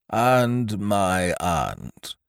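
very slow, sighed-out words; sighing. A narrator's reading. Professor sighing as he introduces his aunt, very slow. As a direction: let it sink as if things get worse. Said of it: "sighed the prof".

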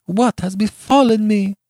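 high, loud, heartbroken moan; anguished moan. A narrator's reading. Beatrice stunned by Giovanni's curses, moaning in anguish. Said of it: "with a low moan out of her heart".

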